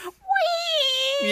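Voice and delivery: very high, drawn-out frog squeal; bullfrog squeal. Retold or imitated. Player imitating a picked-up bullfrog acting intimidating, high squeal. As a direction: imitate a tiny frog's shrill cry. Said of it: "trying to sound intimidating and they just go".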